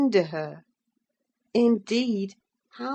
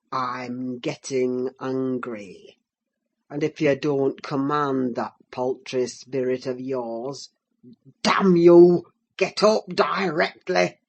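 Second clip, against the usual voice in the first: curbed ferocity; 5 semitones lower, 4 dB louder than usual. Acted. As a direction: hold the fury back, then snap. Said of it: "with curbed ferocity".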